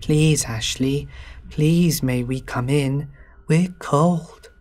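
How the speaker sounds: put-on creepy child pleading, drawn out slowly; slow, eerie childlike begging; weird kid voice. A narrator's reading. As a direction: put on an eerie little kid's plea. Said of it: "putting on my best weird kid voice".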